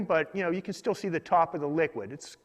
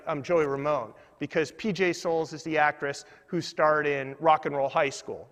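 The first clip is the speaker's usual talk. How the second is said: normal talking voice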